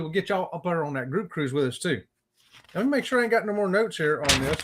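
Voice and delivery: usual speaking voice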